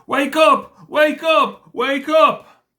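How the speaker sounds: high, loud panicked call to wake; high-pitched, loud repeated calls; urgent cry. A narrator's reading. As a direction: cry out shrilly to rouse a sleeper. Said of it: "and they cried".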